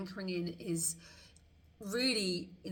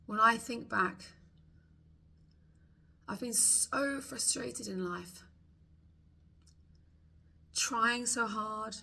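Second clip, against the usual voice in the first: voicing the frustrated client's thoughts for tapping along; tapping script voice. Coach speaks the listener's frustrations aloud, heartfelt tapping script.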